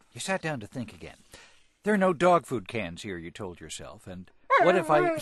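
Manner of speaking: storybook reading. A narrator's reading. Host taking his turn reading the dog story, animated. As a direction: read it big for a child listener.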